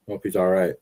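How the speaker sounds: ordinary delivery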